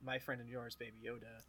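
soft trailing aside; quiet. Real, not acted.

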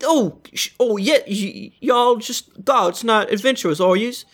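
high, hurried apologetic guard; suddenly meek guard calling to his mate; flustered guard. Acted. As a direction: backpedal fast like a caught-out guard. Guard warns the party.

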